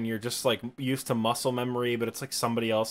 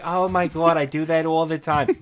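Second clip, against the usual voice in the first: loud, higher put-on Linda Belcher accent; exaggerated Linda Belcher accent; 4 semitones higher, 5 dB louder than usual. Mimicking the accent people associate with the speaker, exaggerated Linda Belcher accent. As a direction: lay the Linda Belcher accent on thick, louder. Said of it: "associate that accent more with me".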